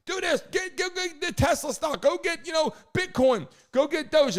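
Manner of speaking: pushy buddy tips. Retold or imitated. Acting pushy buddies giving tips.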